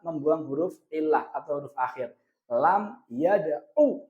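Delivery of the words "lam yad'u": slow, spaced Lam yada'u with a short last u; teacher's demo of Lam yada'u ending on a brief 'u; short final vowel. Shown as a demonstration.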